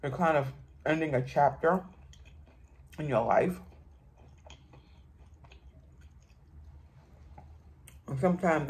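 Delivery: talking while chewing; mouth full, chewing. Real, not acted. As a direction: hand over full mouth. Seen 2: Bite at -3, lips working, hand covers mouth while talking.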